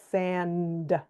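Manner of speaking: "sand" with a held N and a released D; separated ending blend. Shown as a demonstration. Teacher demonstrating an ending blend, "sand" with drawn-out N then D. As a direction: stretch "sand" so N and D are both distinct. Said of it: "You hear the N and the D".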